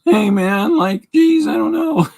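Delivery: very high, loud cartoon slacker voice; Shaggy. Streamer imitating his own voice in his twenties, high Shaggy impression. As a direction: squeak it out like a goofy, hammed-up dude. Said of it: "sounding like Shaggy".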